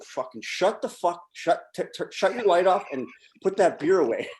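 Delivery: panicked rush to warn him; frantic urging. Retold or imitated.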